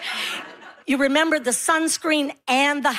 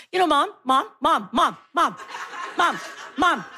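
put-on insistent kid repeating himself; imitating pestering son. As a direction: nag like a child, word after word. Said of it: "He'd be like".

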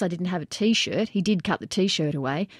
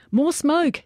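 high, loud shout; yelled order; 9 semitones higher, 4 dB louder than usual. A narrator's reading. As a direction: demand it across the roof. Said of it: "Jager yelled".

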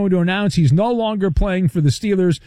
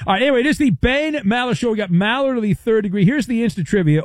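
quick, upbeat show-host intro; radio host read; 5 semitones higher, faster than usual. Acted. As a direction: rattle it off like a sports radio host. Host's radio segment intro.

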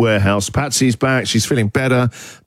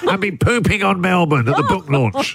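hammed-up poorly caller, higher, laughing; mock calling in sick to the boss; fake sick-day voice; 5 semitones higher than usual. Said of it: "This is the voice you do when you call your boss".

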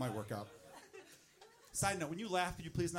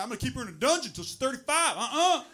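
Southern dad; 6 semitones higher, 6 dB louder than usual. Acted. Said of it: "you know that guy".

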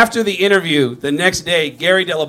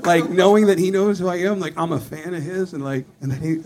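ordinary talk

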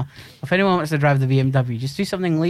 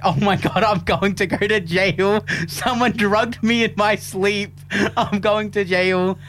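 higher, slow, panicky near-tears voice; 6 semitones higher, slower than usual. Retold or imitated. Retelling to his co-host how he feared jail, higher and near tears. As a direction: imitate your own panic, nearly crying. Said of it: "on the verge of tears".

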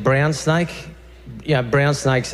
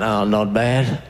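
slow, lower, unbothered drawl; laid-back bitten Aussie; 5 semitones lower, much slower than usual. Retold or imitated. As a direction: play it totally relaxed though dying.

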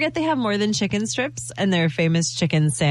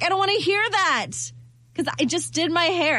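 mock tantrum; 8 semitones higher than usual. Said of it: "And I'm like".

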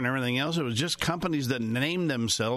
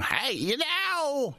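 high, slow Festus twang; 13 semitones higher, much slower than usual. Retold or imitated. Host contrasts Festus's real voice with his TV twang. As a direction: stretch it out like the TV Festus. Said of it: "Instead of going".

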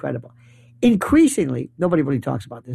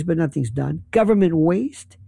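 ordinary talk

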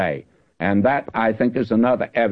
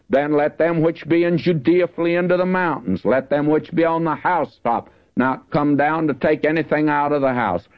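raised, solemn Bible reading voice; scripture reading; 4 semitones higher than usual. Acted. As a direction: read the verse out like from the pulpit. Reads scripture aloud.

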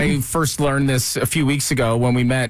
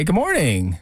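normal talking voice

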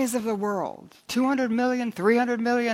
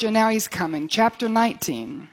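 usual speaking voice

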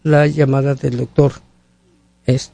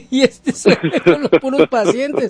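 laughing; 7 semitones higher than usual. Real, not acted. Laughing at his own joke.

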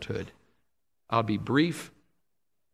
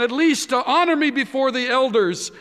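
higher and louder, urgent begging; pleading; 10 semitones higher, 6 dB louder than usual. A narrator's reading. Saul pleads with Samuel to honor him before the elders, urgently. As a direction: beg with rising urgency.

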